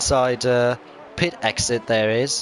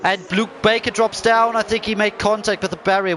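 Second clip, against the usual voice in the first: excited commentary; 7 semitones higher than usual. Real, not acted. Live race incident call.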